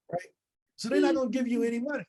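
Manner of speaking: ordinary talk, nothing marked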